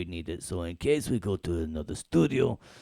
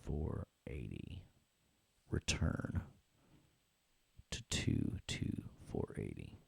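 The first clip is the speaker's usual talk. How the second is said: whispered numbers; 9 semitones lower, 10 dB quieter, slower than usual. Real, not acted.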